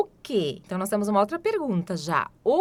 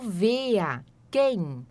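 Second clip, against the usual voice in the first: ordinary delivery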